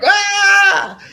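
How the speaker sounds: high, loud drawn-out yell; mock scream. Father mimicking the kids he grabbed, mock scream. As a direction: imitate kids screaming in a held cry. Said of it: "they were screaming the whole time".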